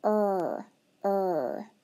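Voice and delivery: "er" sounded as an isolated r-colored vowel; sounding er. Shown as a demonstration. Teacher sounding out er for the spelling task. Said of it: "What about".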